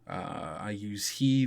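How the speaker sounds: ordinary talk, nothing marked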